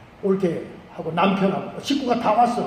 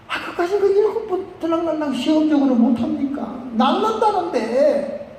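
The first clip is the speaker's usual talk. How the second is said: high-pitched, coaxing protest; urging; 7 semitones higher than usual. Acted. Aide persuading the general to wash seven times, urging. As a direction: plead with the master, stretching the last word.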